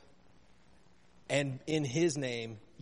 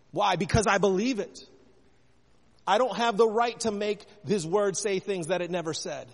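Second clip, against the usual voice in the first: rising, louder preaching; 4 semitones higher, 5 dB louder than usual. Acted. Pastor preaching louder and higher to the congregation. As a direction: drive it home to the church with conviction.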